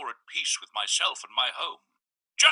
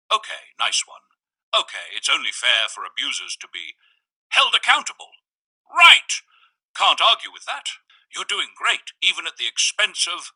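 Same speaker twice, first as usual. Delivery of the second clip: higher, upbeat character voice; the man's voice; 6 semitones higher, 5 dB louder than usual. A narrator's reading. Visitor proposing a game to the narrator, high and upbeat. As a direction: chirp it up high and eager.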